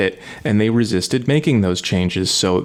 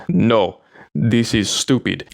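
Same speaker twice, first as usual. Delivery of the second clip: imitated Russian accent, dismissive; slower than usual. Russian friend rejecting pronunciation help, in a Russian accent. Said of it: "He talked very heavy Russian accent".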